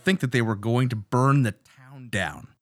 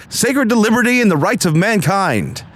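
excited reading; 9 semitones higher, 3 dB louder than usual. Acted. Reading an inscription with exclamations.

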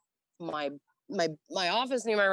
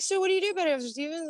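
officer voice; 12 semitones higher, much faster than usual. Retold or imitated. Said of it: "he's like".

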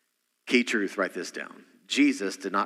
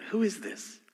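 hushed question; 8 semitones higher, 5 dB quieter than usual. Shown as a demonstration. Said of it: "Very important".

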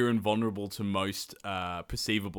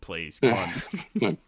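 slow mock plea; 4 dB louder, much slower than usual. Ravana pleading, slowed.

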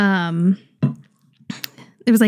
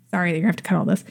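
usual speaking voice

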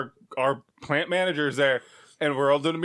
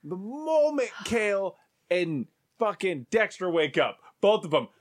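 ordinary talk

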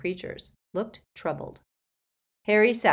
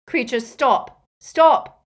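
loud, high shouted order; 7 semitones higher, 12 dB louder, much slower than usual. A narrator's reading. Boy shouts at the elf to stop hurting himself, urgent shout. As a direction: shout to halt him at once. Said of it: "shouted Harry".

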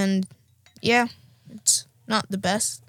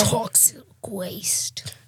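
slow spooky whisper; dramatic whisper; slower than usual. Pretend. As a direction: whisper it slowly like a secret. Dramatic reveal of soda.

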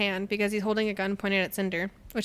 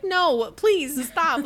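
higher, louder begging; mock desperate plea; 6 semitones higher, 5 dB louder, much slower than usual. Cinder as friends enter the room, mock desperate pleading. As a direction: imitate pleading for it to stop.

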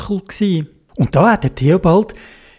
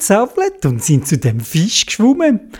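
plain reading voice

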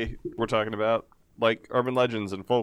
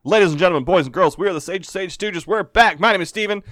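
big booming show host; announcer voice; 9 semitones higher, 8 dB louder than usual. Acted. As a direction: open the show like a ringmaster. Opens the show like an emcee.

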